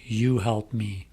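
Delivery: usual speaking voice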